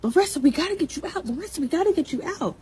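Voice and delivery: high, urgent call of her name; imitating a panicked scream. Girl in back seat shouting to pull driver out, high panicked yell. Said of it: "the girl in the back seat of my car screaming".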